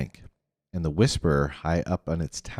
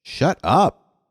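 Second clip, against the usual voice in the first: higher, louder snarl; 10 semitones higher, 5 dB louder than usual. A narrator's reading. Constance snarling at Rainey, high and loud. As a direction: spit it out angrily, pitched up. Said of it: "Constance snarled".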